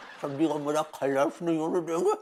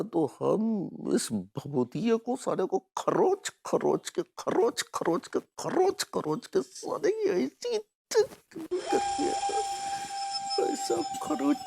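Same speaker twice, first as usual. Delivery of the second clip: building from usual pitch to a high squeal; frenzied revenge threat; 12 semitones higher than usual. Acted. Vows to scratch the rival for भाभी जी, mounting frenzy. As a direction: work yourself up with every repeat. Acted revenge vow, working himself up.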